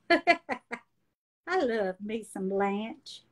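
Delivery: ordinary delivery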